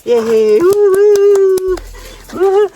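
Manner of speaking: high, loud whoops of joy; excited cheering. Real, not acted. Celebrating a good axe throw with the family, whooping high and loud. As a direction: cheer like you just won.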